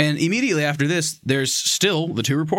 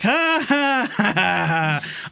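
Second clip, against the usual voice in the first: mock laugh; 8 semitones higher, much slower than usual.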